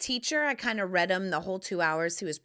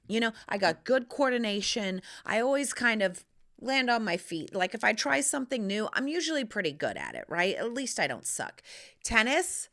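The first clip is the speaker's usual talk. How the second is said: ordinary delivery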